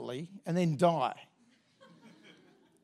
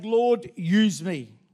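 slow prayer; 5 dB louder, much slower than usual. Acted. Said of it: "He's basically saying".

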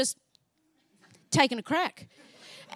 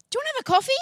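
high voiced invitation; 11 semitones higher, 5 dB louder, much faster than usual. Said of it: "she goes".

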